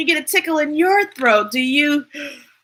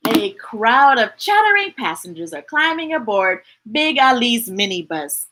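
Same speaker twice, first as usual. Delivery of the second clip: ordinary delivery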